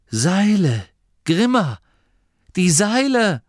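high, loud cry of discovery; aufgeregter Ausruf. A narrator's reading. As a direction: call out the sudden idea urgently. Idea strikes, calls to Grimma urgently. (laut und hoch, plötzlich begeistert)

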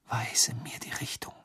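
soft unvoiced whisper. A narrator's reading. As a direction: whisper to the wand. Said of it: "flüsterte er".

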